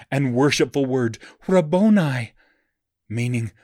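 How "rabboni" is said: joyful cry. A narrator's reading. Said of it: "In a transport of joy".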